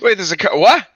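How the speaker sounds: real surprise, pitch leaping high; startled disbelief. Real, not acted. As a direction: blurt it out, rising to a loud, high "what?". Player startled by surprise chimera attack.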